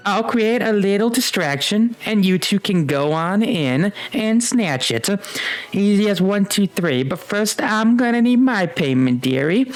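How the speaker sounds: put-on high, swooping old crone voice; old witch Baba Yaga. Game master as Baba Yaga offering help for payment, high old crone. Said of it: "like, feeble old woman in".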